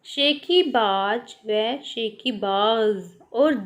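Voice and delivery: शेखीबाज said slowly twice, first plain ज then nukta ज़; teacher slowly contrasts शेखीबाज without and with nukta. Shown as a demonstration. Teacher showing children the nukta sound, slow and clear. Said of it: "बोलकर पढ़िए व पहचानिए कि दोनों में क्या अंतर है".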